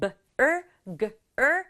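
"B, er, g, er" as separate sounds with pauses; phonics-style breakdown of "B, er, g, er"; sounded out in parts. Shown as a demonstration. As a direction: sound out each piece of "B, er, g, er". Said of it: "er 하는 소리 내주면 돼요".

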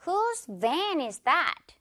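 slow model sentence. Shown as a demonstration. Teacher leading learners together, slow and clear. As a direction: space out each word for repeating. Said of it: "같이 한번 가볼까요?".

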